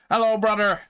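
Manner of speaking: "Hello, brother" belted out high and loud in a mock Irish accent; hammed-up Irish greeting. Shown as a demonstration. Showing viewers his Irish-sounding brother, loud mock Irish. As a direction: throw "brother" out like an over-the-top Irish pub greeting. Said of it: "really Irish".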